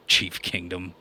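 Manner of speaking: ordinary talk, nothing marked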